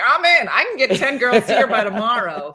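Ophelia eagerly rallying. Retold or imitated. Friend imagining Ophelia signing up girls to ship, loud and eager. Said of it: "Ophelia's like".

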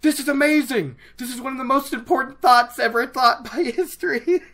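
shrieking high and loud, slowed on oh my god; like you just read the best line ever; giddy fangirl squeal breaking into a laugh; ecstatic outburst. Retold or imitated. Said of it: "I basically just dropped the book and was like".

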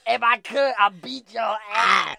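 high, loud, slow menacing voice; mock tough-guy threat. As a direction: threaten them like a cartoon bully, drawn out. Playful threat in a put-on voice.

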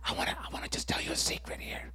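hushed, quick mock whisper; barely audible confiding whisper; secretive whisper. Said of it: "Jesus didn't say".

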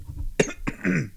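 sudden dry cough; coughing on dust. Real, not acted. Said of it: "I inhaled some dust".

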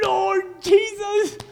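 drawn-out high anguished cry to Jesus; slow high cry of anguish. Acted. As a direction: wail it slowly, broken. Anguished sinner crying out.

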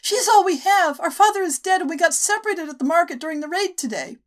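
put-on high, earnest child voice; a boy begging the men for pity; young boy pleading. Boy tells men their story.

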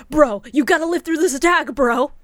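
loud bro-dude voice; frat bro. Retold or imitated. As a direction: yell it like a gym bro. Bromance frat bro quote.

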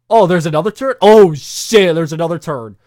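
loud, high burst of surprise; mock astonishment. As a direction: act out being blindsided by yet another twist. Acting out reaction to plot twists.

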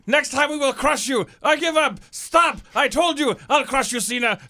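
high, loud, slow Bulgarian rant; Rusev Bulgarian accent. Retold or imitated. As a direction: bellow it like an angry Bulgarian strongman. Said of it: "with that accent".

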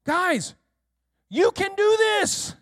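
rally the team, you can do this; Jesus cheering on the disciples, high and encouraging. Pretend. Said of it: "You know what he's saying".

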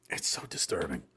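hushed unvoiced whisper of disgust. Real, not acted. Disturbed by the photo, whispered. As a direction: breathe it out, no voice.

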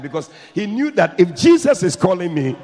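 loud, high, driving preacher's call; preacher urging the congregation loudly; preaching at full force. Real, not acted. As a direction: press it on the crowd with full force.

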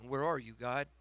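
normal talking voice

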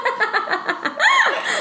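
high squealing laugh; a squeal of laughter at her own joke. Real, not acted.

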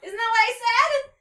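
high, loud, slowed yell; exasperated shout. A narrator's reading. Rumpelteazer shouting down the tree, exasperated. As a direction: shout it, as if obvious.

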